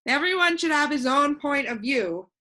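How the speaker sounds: put-on a cheerful know-it-all boy floating in the air; voiced boy Alec.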